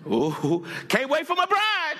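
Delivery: high, eager calling out; preacher imagining the bridegroom's cry. Retold or imitated. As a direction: voice the Lord's longing with excitement. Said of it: "He might shout".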